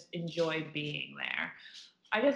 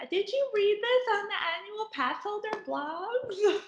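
imitating mom; 13 semitones higher than usual. Imitating mom's text.

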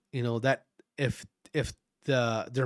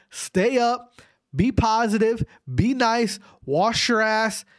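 hyped sign-off; 7 semitones higher, slower than usual. Real, not acted.

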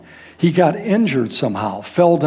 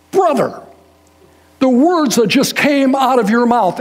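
raised, louder, urgent and slow; emphatic retelling; 7 semitones higher, 5 dB louder than usual. Retold or imitated. Reuben telling the preacher, in a raised emphatic voice. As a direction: Reuben pressing his point hard. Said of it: "he emphasizes".

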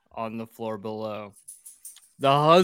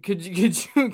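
usual speaking voice

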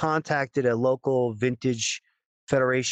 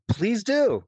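eager; 9 semitones higher than usual. Retold or imitated. Retold eager request.

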